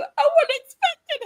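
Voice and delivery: mock tearful viewer.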